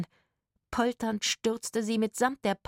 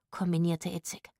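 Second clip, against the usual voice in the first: ordinary delivery, nothing marked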